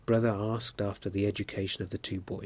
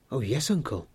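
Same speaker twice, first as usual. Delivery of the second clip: joyful; 3 semitones higher than usual. A narrator's reading. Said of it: "his joy knew no bounds".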